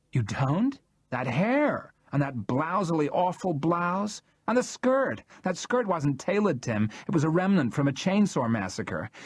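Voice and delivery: high, theatrical, rising disdain; camp scorn. A narrator's reading. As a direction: ham up his horror at her outfit. Charles mocks Jackie's clothes.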